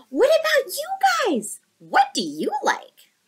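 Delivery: high curious asking voice; eager question. Acted. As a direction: ask it with wide eyes. Kai asks friends eagerly.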